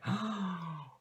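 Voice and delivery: a quick, high shocked gasp; sharp gasp. Real, not acted. Gasping in dismay as the bad card hits. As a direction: suck in air at the bad draw.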